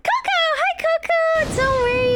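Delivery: high, loud squeal of welcome; squealed high-pitched hello; excited greeting. Real, not acted. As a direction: call out to a friend just arrived. Greets arriving viewer.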